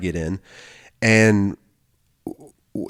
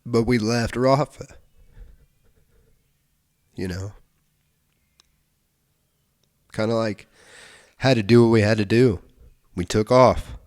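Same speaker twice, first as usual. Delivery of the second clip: real tearful. Real, not acted. Retelling leaving Rafa behind, fighting real tears. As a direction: hold back tears while speaking. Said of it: "I wouldn't be crying".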